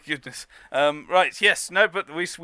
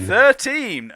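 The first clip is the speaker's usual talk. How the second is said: excited; 7 semitones higher, 10 dB louder than usual. Real, not acted. Echoing the bingo call excitedly.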